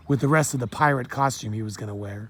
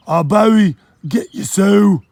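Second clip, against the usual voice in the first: loud, higher Jamaican dockhand calling out; heavy Jamaican accent; 7 semitones higher, 10 dB louder, slower than usual. Acted. Dockworker hails the boy in a heavy Jamaican accent. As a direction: boom it out in thick Jamaican patois.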